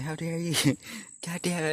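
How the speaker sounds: high-pitched mock outrage broken by laughter; playfully indignant, giggling through it; Nathan. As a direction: imitate a friend's teasing scolding. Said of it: "Nathan was one".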